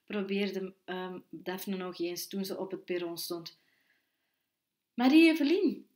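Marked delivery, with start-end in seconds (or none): 4.9-5.9 loud, high call of a name. A narrator's reading. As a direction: call to someone across a crowd. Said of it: "iemand mijn naam riep".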